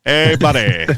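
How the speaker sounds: deliberately bad comedian impression, words stretched; Robin Williams. Shown as a demonstration. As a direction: drag out the greeting as a goofy impression. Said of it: "Just do really bad Robin Williams impressions".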